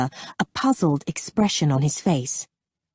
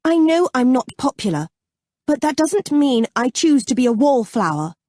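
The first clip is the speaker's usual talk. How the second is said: higher, indignant protest; rising temper; 8 semitones higher, 4 dB louder than usual. A narrator's reading. Abby answering Freddie's slight, temper rising. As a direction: defend yourself hotly, pitch up. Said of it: "felt her temper rising".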